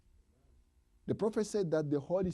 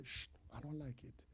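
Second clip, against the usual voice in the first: whispered shh and refusal; whispered shush; 4 semitones lower, 17 dB quieter than usual. Acted. Preacher acting a believer hushing the Spirit. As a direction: hush it dismissively in a whisper. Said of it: "you say".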